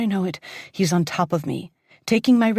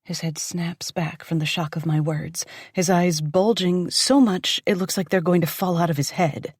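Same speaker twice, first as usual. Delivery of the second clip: ordinary delivery, nothing marked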